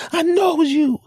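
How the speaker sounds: high, loud, squealing mock cursing tirade; cursing rant. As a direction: rattle off censored curses in a shrill fury. Driver insists angrily.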